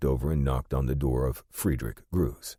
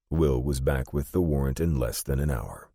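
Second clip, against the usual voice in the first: usual speaking voice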